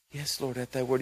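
hushed, low, mock-reverent obedience; mesmerized. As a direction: answer softly, as if entranced. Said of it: "He's mesmerized".

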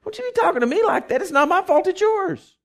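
high, quick indignant scolding; God. Acted. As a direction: throw the blame straight back. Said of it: "this is what he says".

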